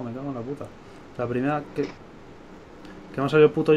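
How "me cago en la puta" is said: muttered curse, much softer; cursing under breath. Real, not acted. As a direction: curse quietly to yourself. Annoyed, lost recordings of match.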